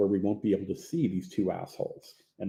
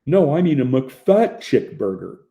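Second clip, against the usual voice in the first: louder, higher, slow oafish insistence; slow stupid drawl; 4 semitones higher, 6 dB louder than usual. A narrator's reading. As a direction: drawl the correction loudly like a dolt. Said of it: "In a slow, stupid drawl".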